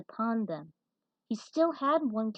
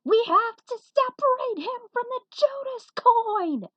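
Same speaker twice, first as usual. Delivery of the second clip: high, loud urgent shout; scream; 9 dB louder than usual. Acted. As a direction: yell the warning slowly. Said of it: "He screamed just enough".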